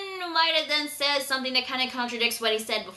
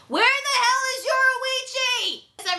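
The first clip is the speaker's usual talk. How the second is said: exasperated; 13 semitones higher, 4 dB louder, much faster than usual. Real, not acted. Exasperated about a missing character.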